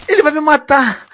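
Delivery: high, loud cry of alarm; panicked exclamation. Real, not acted. Player being attacked, loud panicked cry. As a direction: call out as if under attack.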